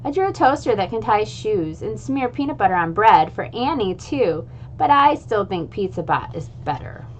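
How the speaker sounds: plain reading voice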